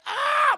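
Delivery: high, loud yelp of pain. Retold or imitated. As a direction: yelp as if hitting the dresser. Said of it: "She's like".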